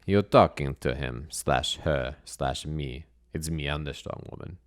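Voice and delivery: put-on slow, accented strongwoman introduction; deliberate, heavy-accented correction; blunt strong woman. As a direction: play a terse circus strongwoman with an accent.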